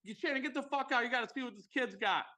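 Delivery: high, fast Boston accent imitation. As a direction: excitedly summon a bandmate, mock-Bostonian. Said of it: "that whatever Boston accent".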